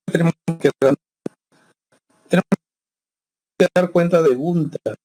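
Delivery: breaking up, bad connection audio; choppy audio. Real, not acted. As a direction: audio cutting in and out over bad internet. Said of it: "se les escucha entrecortado".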